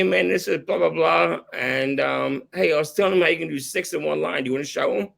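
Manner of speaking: ordinary talk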